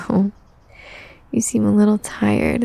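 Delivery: normal talking voice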